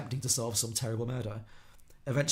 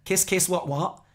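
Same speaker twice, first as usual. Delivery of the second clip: loud, higher-pitched chavvy Scouse; chavvy Scouse accent; 7 semitones higher, 7 dB louder, slower than usual. Shown as a demonstration. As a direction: belt it out in a put-on young Scouse accent. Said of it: "go back to my Scouse accent".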